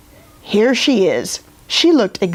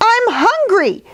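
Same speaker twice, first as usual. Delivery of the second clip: squealing princess. Acted. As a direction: squeal it like a greedy little princess. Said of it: "The princess squealed".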